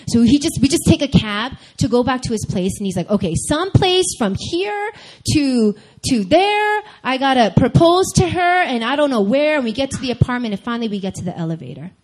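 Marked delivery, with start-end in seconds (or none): 3.1-9.6 high, louder imitated panic; flustered planning. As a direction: think aloud frantically, stalling mid-phrase. Acts boyfriend frantically planning proposal.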